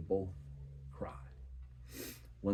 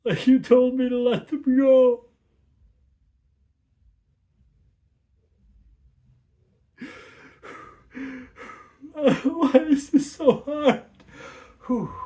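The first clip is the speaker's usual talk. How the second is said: much higher, louder, breaking cry; tearful plea to Father; 14 dB louder than usual. Acted. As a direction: cry it out like a hurt child calling his father, slowing at the end. Inner child part cries to Father.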